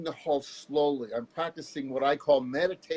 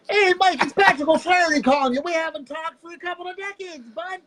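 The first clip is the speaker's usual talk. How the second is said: high, loud put-on caller voice; prank caller voice; 13 semitones higher, 9 dB louder than usual. Guest hamming up a fake old friend on the phone, high and loud. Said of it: "pretended to be somebody else".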